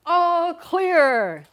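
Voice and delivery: high, loud call of all clear; attendant yelling. Retold or imitated. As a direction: shout it like the ride attendant. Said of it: "and he yells".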